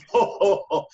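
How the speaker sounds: imitating a big high, loud ho-ho belly laugh; a hammed-up jolly belly laugh, like a rich mentor amused; Santa Claus belly laugh. Retelling how the billionaire laughed at his answer, big imitated belly laugh. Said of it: "He had a big old Santa Claus belly laugh".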